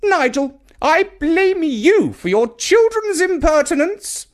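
put-on high, loud, stern old woman's rebuke; scolding Grandma. A narrator's reading. Grandma scolding her son Nigel, high and stern. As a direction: blame him from on high. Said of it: "she said".

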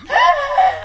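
sharp high gasp of fright; frightened gasp. Acted. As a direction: gasp in sudden fear. Amina frightened by rat.

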